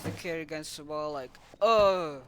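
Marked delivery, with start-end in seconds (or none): 1.6-2.2 loud pained cry. Retold or imitated. Kid smashed against the wall, loud cry.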